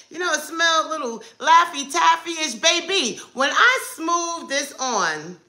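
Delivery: ordinary talk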